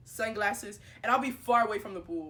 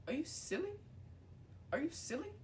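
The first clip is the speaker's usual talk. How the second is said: high, soft disbelieving question; mock disbelief, lifted and quiet; incredulous retort; 7 semitones higher, 10 dB quieter than usual. Acted retort to the girls.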